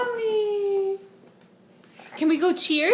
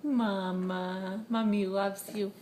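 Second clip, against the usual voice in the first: tender; 10 semitones lower, 8 dB quieter than usual. Real, not acted. Mom softly telling her baby she loves him.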